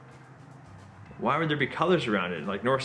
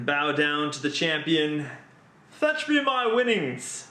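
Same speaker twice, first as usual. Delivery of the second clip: mock-regal gloating; slower than usual. Winner lording it over the others, mock-regal. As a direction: proclaim victory slowly like a grand ruler. Gloats as champion after winning.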